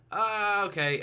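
high, loud drawn-out exclamation; imitating own catchphrase. Podcast host parodying his own on-air habit, exaggerated self-imitation. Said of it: "every time I go".

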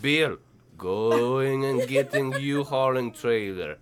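slow Russian mother giving orders; fake Russian accent. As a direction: play a Russian wife bossing in broken English. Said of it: "That's exactly what she said".

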